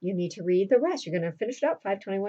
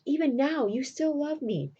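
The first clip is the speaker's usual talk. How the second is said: higher-pitched retold pleading; insistent; 7 semitones higher than usual. Roxanne presses Cyrano about his love, insistent. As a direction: press the claim in a lifted voice.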